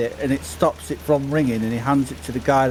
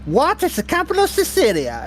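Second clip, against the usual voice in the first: much higher, louder mock keeper; spring the trick question in a high put-on voice; mock bridgekeeper trick question; 3 dB louder than usual. Dad quizzing his daughter at bridge, high mock keeper. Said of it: "And I'd be like".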